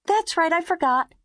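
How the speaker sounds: put-on high child voice with a grin; grinning little girl. A narrator's reading. Mimi remembers to the babysitter, grinning. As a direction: smile through the words.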